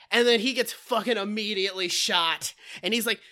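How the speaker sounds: normal talking voice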